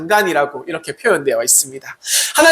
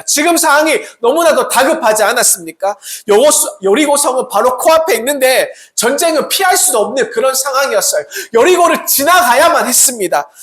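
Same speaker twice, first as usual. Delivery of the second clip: ordinary delivery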